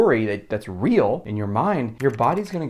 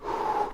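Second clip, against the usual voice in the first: soft full exhale; deep audible breath; 6 dB quieter than usual. Shown as a demonstration. Showing the breath again, soft and long. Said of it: "sounds something like this".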